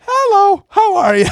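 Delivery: very high, loud, sing-song hello; mock greeting. Coworkers teasing the welder with the mitts, squeaky mock hello. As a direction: greet him in a silly squeaky voice.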